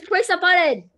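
exasperated shouting at the screen; exasperated outburst. Real, not acted. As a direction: yell it in disbelief at someone clueless. Exasperated heckling at the student on screen.

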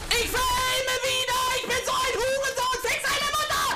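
high furious screaming; berserk rage. Real, not acted. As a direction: yell in frustrated rage. Gamer raging after another death. (schreiende Wut)

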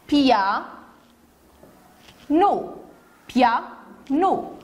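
"piano" as pia, long pause, no; syllable by syllable. Shown as a demonstration. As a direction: count out "piano" in two beats. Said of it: "Deux syllabes".

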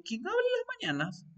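high-pitched, soft, quick mock questioning; quick little put-on high voice; acted waking-up question. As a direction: act out asking in a small, high voice. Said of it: "Ahí me levanto y digo".

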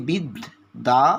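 "বিদ দা" broken into two syllables, bid, then daa; teacher splitting "বিদ দা" at the doubled d; syllable-by-syllable pronunciation. Shown as a demonstration. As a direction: sound out "বিদ দা" with a clear gap before দা. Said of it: "আমরা উচ্চারণ করছি".

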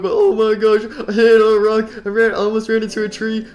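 high, loud frantic car voice; a lost taxi panicking; panicky taxi car voice. Pretend.

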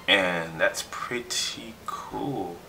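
slow, soft whispered aside. Real, not acted. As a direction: breathe it out slowly.

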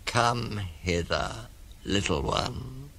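slow, soft beckoning; coaxing crocodile. Acted. Crocodile lures the elephant child closer, slow and soft. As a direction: lure gently, stretching each word. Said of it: "said the crocodile".

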